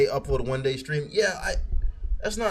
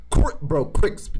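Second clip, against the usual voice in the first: ordinary talk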